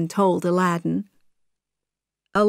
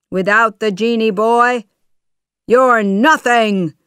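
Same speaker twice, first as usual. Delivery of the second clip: raised voice, louder and higher; scornful shout; 4 semitones higher, 6 dB louder than usual. A narrator's reading. Jafar holds Aladdin in his tail, shouting scornfully. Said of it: "he shouted".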